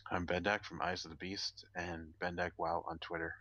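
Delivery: usual speaking voice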